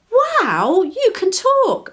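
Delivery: high, loud and slowed wonder; girl amazed a worm speaks. Acted. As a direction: gasp it out in disbelief. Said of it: "exclaimed Daisy".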